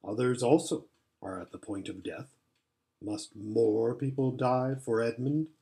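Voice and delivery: low, solemn rebuke; Aslan, graver. A narrator's reading. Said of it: "said Aslan in a graver voice".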